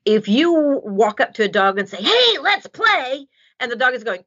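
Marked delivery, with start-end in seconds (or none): 2.0-3.3 high, slow and gushing; overeager greeting. Retold or imitated. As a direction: sing it out way too keen at the dog. Person greeting a shy dog loudly.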